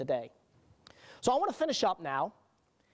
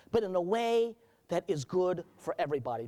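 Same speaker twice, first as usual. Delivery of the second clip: ordinary talk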